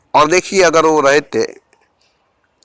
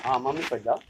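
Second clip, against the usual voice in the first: soft coaxing; 18 dB quieter than usual. Real, not acted. Father softly sends his child off to mummy, hushed and gentle. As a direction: softly send the little one to mummy.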